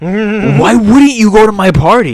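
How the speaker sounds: loud, slow, high-pitched mock outrage; imitating an outraged buddy yelling; indignant disbelief. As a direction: stretch it out as an offended yell. Acted indignant reply, continued.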